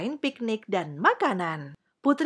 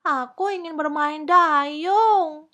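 high, loud, drawn-out whine; upset, sulky child; 7 semitones higher, 6 dB louder, slower than usual. A narrator's reading. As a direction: protest like a cross little princess. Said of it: "Putri Kecil kesal".

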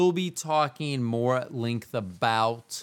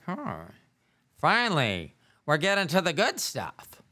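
higher, relieved "finally" of a listener perking up; mock eager, perked-up listeners; 6 semitones higher than usual. Imagined listeners perking up at the racy story, perked-up interest. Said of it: "they perked up and were like".

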